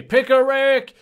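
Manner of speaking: high, loud catchphrase yell; over-the-top high shout; joking Pickle Rick cry. Acted. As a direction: belt it out ironically. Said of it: "like, when I say".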